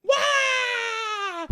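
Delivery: loud, very high wah; mock baby bawl. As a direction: wail like a cartoon cub. Baby Bear bawls.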